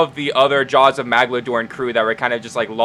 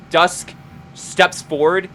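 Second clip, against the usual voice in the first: normal talking voice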